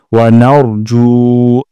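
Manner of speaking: "নারজু" with জু held one alif long; slow, stretch the জু; lengthened ju. Shown as a demonstration. Teacher showing the long জু. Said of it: "জুতে এক আলিফ টান দিব".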